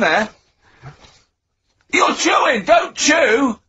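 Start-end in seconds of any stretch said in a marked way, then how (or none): 1.9-3.6 shouting. Real, not acted. Scolding Carl for chewing.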